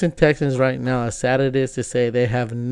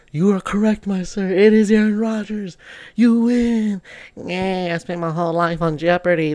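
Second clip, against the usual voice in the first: higher, cheery game show host voice; mock Jeopardy host; 5 semitones higher than usual. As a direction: ham up a quiz show moment, pitched up. Playing Jeopardy contestant and host.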